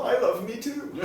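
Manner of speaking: cracks up mid-line, high and loud; laughing punchline. Real, not acted. Telling his friends his punchline, laughing high and loud. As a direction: laughs through his own cocky joke.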